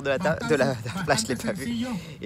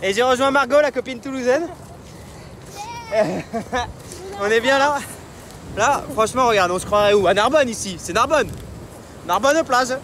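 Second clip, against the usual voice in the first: shouting over wind; 9 semitones higher, 6 dB louder than usual. Real, not acted. Running outdoors, loud and high.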